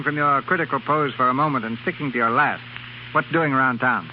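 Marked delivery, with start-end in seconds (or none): none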